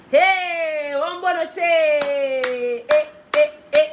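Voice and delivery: loud, high, stretched hype call; hype shout. Real, not acted. Singer hypes her live viewers with a loud stretched shout. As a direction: call out to the crowd, slow and loud.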